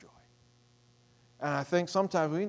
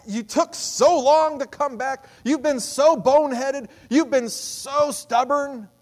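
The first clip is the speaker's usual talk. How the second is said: scolding father; 8 semitones higher, 9 dB louder, slower than usual. Acted. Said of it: "He's not saying".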